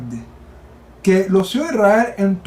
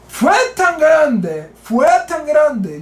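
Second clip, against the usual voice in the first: preacher shouting the point slowly; fervent build; 5 semitones higher, slower than usual. Real, not acted. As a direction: preach it out with mounting intensity. Preacher rising to a climax.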